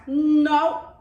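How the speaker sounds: loud firm no. Acted. Kind deer rejects the sneeze, loud no. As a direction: shut it down.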